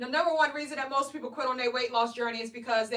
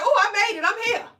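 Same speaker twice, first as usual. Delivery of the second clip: retold delight, higher and louder; mock triumph; 6 semitones higher, 6 dB louder than usual. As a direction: cheer it like you finally made it. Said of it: "I was like".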